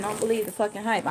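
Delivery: ordinary talk, nothing marked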